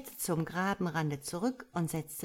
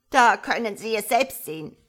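loud, higher indignant frog mother; upset complaint; 4 semitones higher, 11 dB louder than usual. A narrator's reading. Frog complains to the starling, loud and upset. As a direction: burst out in wounded protest.

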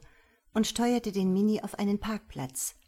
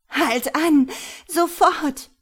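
high, panicked scream to stop; loud, frantic shriek; voice cracking; 9 semitones higher, 10 dB louder, slower than usual. A narrator's reading. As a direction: shout it in desperation, pitch leaping up. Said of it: "Ihre Stimme überschlug sich".

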